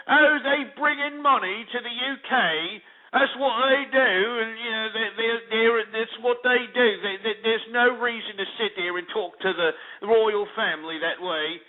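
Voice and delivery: high mocking English-accented woman; pompous English accent. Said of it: "that stupid pompous ass English accent".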